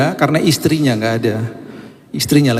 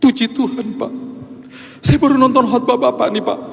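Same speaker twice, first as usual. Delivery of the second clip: crying; 11 semitones higher than usual. Retold or imitated. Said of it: "Terus dia nangis".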